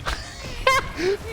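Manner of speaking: high-pitched burst of laughter; delighted laugh. Real, not acted. Commentator amazed at a double kill, high delighted laugh.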